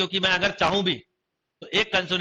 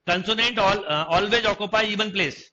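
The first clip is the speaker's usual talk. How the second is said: slow dictation; much slower than usual. Shown as a demonstration. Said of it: "teacher dictating the rule".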